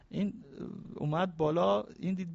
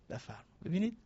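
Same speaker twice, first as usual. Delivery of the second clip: usual speaking voice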